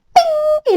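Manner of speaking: high, bright ping as the ship flies; ping sound effect. Acted. Storyteller flicking soldiers' ship away: high ping.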